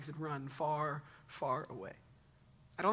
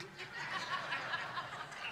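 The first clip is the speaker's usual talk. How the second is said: laughter; 12 semitones higher, 6 dB quieter than usual. Real, not acted. Joking about youth pastors.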